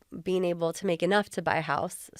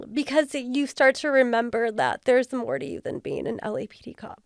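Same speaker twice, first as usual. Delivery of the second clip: emotional; 5 semitones higher than usual. Real, not acted. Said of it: "I'm gonna get all emotional".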